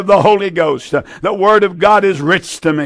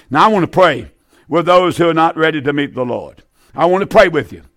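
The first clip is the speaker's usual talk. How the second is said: normal talking voice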